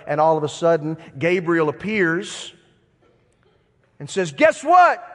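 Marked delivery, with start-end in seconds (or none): 4.3-5.0 high, loud, playful burst; spring big news on someone as the angel; excited announcement. Acted. Angel's surprise announcement acted out.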